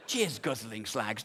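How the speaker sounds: low, slow, prim disapproval of the title; disapproving repeat. Shown as a demonstration. Repeating a rude film title, slow and disapproving. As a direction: say it like a disgusted moral guardian. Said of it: "Do you have to call them slags?".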